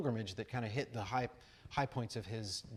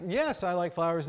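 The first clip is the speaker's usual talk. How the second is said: loud, high retold reply; calling back; 7 semitones higher, 10 dB louder than usual. Walker answering the British rambler, loud mock call back. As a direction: call it back loudly, pitched up.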